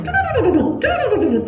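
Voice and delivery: high, quick "duduk, duduk" mimicking light running steps; quick hopping sounds. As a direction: patter it out high like a little deer darting. Imitating the kancil's quick steps.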